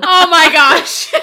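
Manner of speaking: loud high laughing oh my gosh, slow; burst of laughter. Real, not acted. Reacting to the daughter's publisher mix-up in a loud high burst of laughter. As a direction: crack up at the cute mistake.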